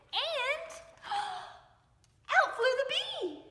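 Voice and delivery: a gasp, then high, loud and slow; gasping surprise. A narrator's reading. Narrator revealing the bee flying out, gasping surprise. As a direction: gasp and let it burst out high.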